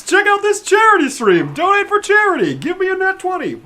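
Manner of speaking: high, swooping pitchman delivery; mock promo plug. Player to stream viewers for charity, mock promo plug.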